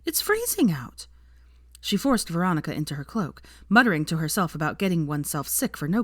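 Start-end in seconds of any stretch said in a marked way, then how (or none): 0.0-1.1 much higher, slow, shocked scold; aghast scolding. A narrator's reading. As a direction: scold her for dressing too lightly. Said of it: "Hermione said aghast".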